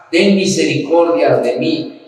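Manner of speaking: usual speaking voice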